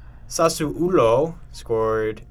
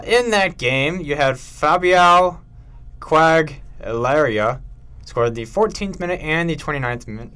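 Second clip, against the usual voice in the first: usual speaking voice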